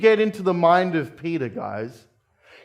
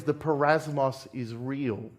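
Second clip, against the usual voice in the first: the refrain dropped much softer; the parasmos is real, said low and quiet; hushed refrain; 3 semitones lower, 6 dB quieter than usual. Acted. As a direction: let it fall to a grave, soft close. Sermon refrain, repeated softly.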